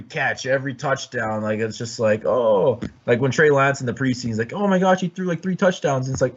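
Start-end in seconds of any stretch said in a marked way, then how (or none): 4.5-6.1 high-pitched overexcited fan imitation; mock gushing. Rival mimicking fans who post every touchdown, mock gushing. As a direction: gush in mock amazement, pitched up.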